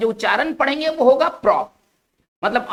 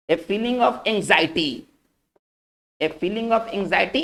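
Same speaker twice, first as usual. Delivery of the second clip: dictation pace; 3 semitones lower, 4 dB quieter, much slower than usual. Shown as a demonstration. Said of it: "dictating the meaning slowly".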